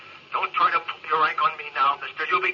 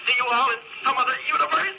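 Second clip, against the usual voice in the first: normal talking voice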